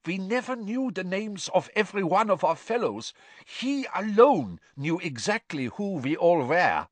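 eager to seem useful; nervous prisoner. A narrator's reading. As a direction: Karkaroff explaining to Crouch. Karkaroff keeps pleading.

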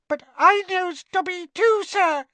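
loud, very high elf squeal; squeaky house elf. A narrator's reading. Elf tells Harry she knows Dobby, high squeak. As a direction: pipe up in an eager tiny squeak. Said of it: "squeaked the elf".

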